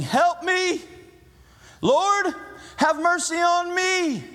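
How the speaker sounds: high pleading cry, stretched out; desperate cry for mercy. Retold or imitated. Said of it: "he was saying".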